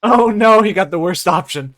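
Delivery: loud, high outburst of dismay; alarmed delight. Real, not acted. Game master reacting to the worst mushroom pick, loud alarmed outburst. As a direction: cry out, gleefully horrified, then ease down slightly. GM reacts to player's risky choice.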